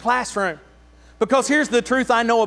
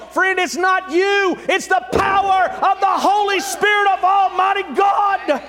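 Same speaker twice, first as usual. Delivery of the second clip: higher, slow, emphatic proclamation; slow thunderous preacher's declaration; slow fiery preaching; 7 semitones higher, slower than usual. Acted. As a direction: drive it home to the crowd. Preaching at full force.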